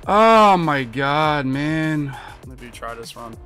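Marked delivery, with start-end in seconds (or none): 0.1-2.2 exasperated groan. Real, not acted. Groans at a bad play.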